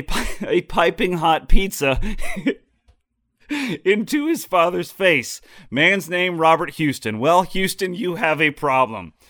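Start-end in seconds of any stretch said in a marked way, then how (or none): 0.0-5.4 laughing through the words; can't hold back a laugh. Real, not acted. Laughs while telling story.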